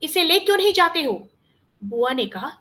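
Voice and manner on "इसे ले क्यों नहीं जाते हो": raised, higher-pitched rebuke; sharp scolding. A narrator's reading. Uncle ordering Prakash to take the boy, scolding. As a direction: scold him impatiently, voice lifted. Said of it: "मैंने डांट कर कहा".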